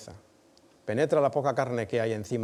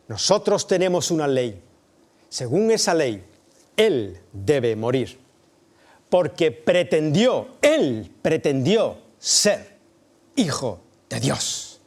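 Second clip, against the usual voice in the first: loud, slow, high insistent declaration; desperate accusation; 4 semitones higher, 9 dB louder, slower than usual. Acted. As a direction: hammer out the law word by word. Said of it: "los judíos le respondieron".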